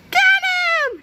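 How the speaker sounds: very high, loud screech; shouted. A narrator's reading. Old ladies setting the mob on Melvin, shouted. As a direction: screech it as a charge order. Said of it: "shouted the old ladies".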